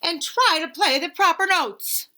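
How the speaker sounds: a touch of a cross teacher; snapping. Acted. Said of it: "snaps Maestro".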